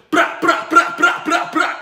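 high, loud brassy "bra, bra" noise; mock trumpet fanfare. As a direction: blast out a playful fanfare. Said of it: "Watch this".

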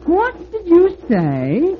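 very high, slow, coy lady's voice; high sly call of a water tower. Acted. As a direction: call out sweetly as the flattering tower. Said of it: "called the water tower".

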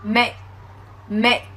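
"mẹ" said like "make" with the K held and never released; "mẹ" as an English "make" with a silent, unreleased K stop. Shown as a demonstration. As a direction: stop the final K without any puff of air, "mẹ". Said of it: "bây giờ em sẽ đọc là mẹ".

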